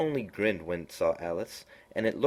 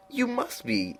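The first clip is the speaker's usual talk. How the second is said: high, slow, drawn-out cat voice; lofty knowing cat, high and slow; Cheshire Cat voice; slower than usual. A narrator's reading. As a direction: purr it out like a sly grinning cat.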